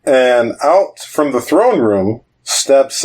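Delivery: normal talking voice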